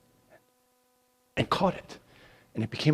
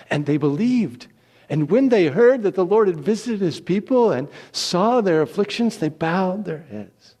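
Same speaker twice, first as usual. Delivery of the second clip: uplifted; 10 semitones higher, 10 dB louder than usual. A narrator's reading. Climax of the people's belief.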